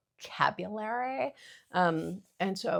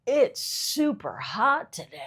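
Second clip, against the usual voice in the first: slow, higher, louder surfer dude; lazy sunny beach-bum drawl; 5 semitones higher, 6 dB louder, slower than usual. Shown as a demonstration. She notices she keeps slipping into a surfer voice. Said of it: "I don't know why I'm doing, like, a surfer voice".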